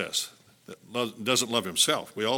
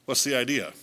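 usual speaking voice

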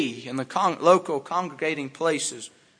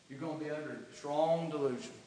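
quiet warning; 2 semitones lower, 10 dB quieter than usual. Real, not acted.

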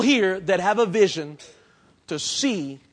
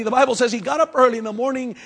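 usual speaking voice

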